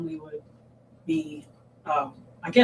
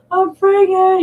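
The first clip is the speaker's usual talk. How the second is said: high, louder mock-crying confession; retold tearful wail; 13 semitones higher, 8 dB louder, much slower than usual. Mother confessing pregnancy to her daughter, acting out hysterical crying. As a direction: re-enact a distraught wail, pitched way up. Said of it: "I was hysterical crying".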